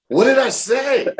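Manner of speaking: high, slow, incredulous retold cry; alarmed question. Pranked wrestler asking what he said, high alarmed cry. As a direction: squeal it out in dawning alarm.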